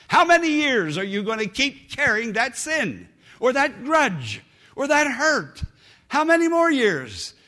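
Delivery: loud, high preaching; fiery preaching. Acted. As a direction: preach it with full force. Preaching at full force.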